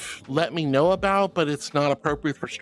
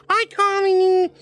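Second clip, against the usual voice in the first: squeaky cute greeting; 8 semitones higher, 5 dB louder than usual. Real, not acted. Squealy greeting to chat members.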